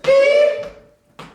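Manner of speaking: very high "Beep"; high squeak. Shown as a demonstration. Cueing one side of the room, high squeak. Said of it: "Let's hear it".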